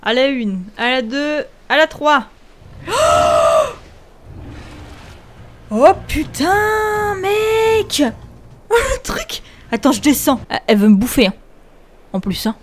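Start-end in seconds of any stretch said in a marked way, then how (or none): 2.9-3.7 sharp startled gasp; frightened gasp. Real, not acted. Xenoqueen appears, jump scare. 5.7-9.4 high, slow, stunned fright; freaked out by the monster; scared horror game streamer. Real, not acted. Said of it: "Parce que moi, j'ai super peur".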